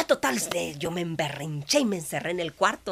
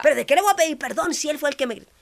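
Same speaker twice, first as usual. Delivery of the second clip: indignant protest; 7 semitones higher, 8 dB louder, faster than usual. Retold or imitated. Retells arguing back to God.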